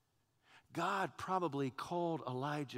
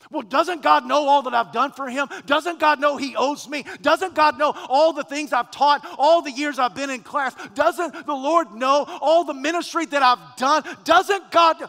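louder, higher entitled complaint; indignant churchgoer; 10 semitones higher, 17 dB louder than usual. Retold or imitated. Preacher voicing a self-righteous believer, loud indignant demand. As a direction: demand God's thanks, building. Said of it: "why are you here today".

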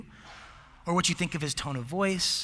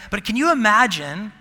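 louder, higher, amused disbelief; excited, incredulous; 5 semitones higher, 9 dB louder than usual. Real, not acted. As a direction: play up how absurd it would be. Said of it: "I don't even know what sauntering looks like".

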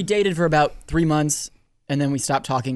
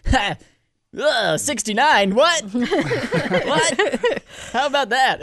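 deflecting joke; 10 semitones higher, much slower than usual. Retold or imitated. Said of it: "I'd try to be like".

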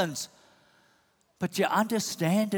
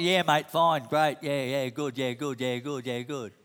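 typical Aussie; 6 semitones lower, 3 dB quieter than usual. Retold or imitated. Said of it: "the typical Aussie".

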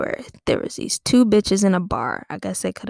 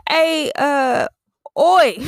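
high, loud mock British call; hollering husband; 8 semitones higher, 7 dB louder, much slower than usual. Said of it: "her husband came home and was like".

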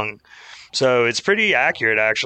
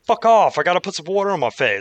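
higher, sharp fuck off; mock irritated outburst; 6 semitones higher than usual. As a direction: mimic a fed-up cameraman. Retold cameraman outburst.

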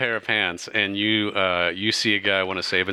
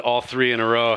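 ordinary delivery

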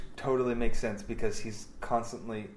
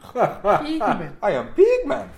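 high, loud hammed-up impersonation; crazed director declaring himself, much higher and louder; Tommy Wiseau; 10 semitones higher, 10 dB louder, slower than usual. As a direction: proclaim it in a put-on, high-pitched holler. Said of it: "when I think of Tommy Wiseau".

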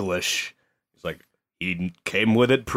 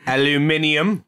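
put-on British accent; 4 semitones higher than usual.

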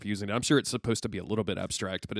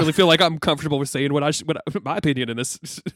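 higher, louder, hesitant rambling; Da Vinci, nervous; 6 semitones higher, 7 dB louder than usual. Acted. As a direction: stammer out an unsure non-answer. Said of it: "And he's like".

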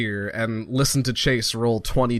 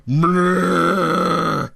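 raised growling roar; bear growl battle cry; 5 semitones higher, 3 dB louder than usual. Acted. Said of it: "you hear Mordecai's battle cry".

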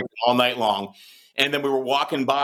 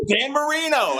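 high, drawn-out name in retold excitement; retold starstruck insistence; 11 semitones higher, slower than usual. Fan brushing off another celebrity for the quarterback, retold awe. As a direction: lift the name high, imitating an awed fan. Insists on the star, not Cortez.

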